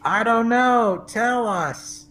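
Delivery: high slow yokel reply; mock audience. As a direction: play along as a yokel crowd. Playing audience to squirrel joke.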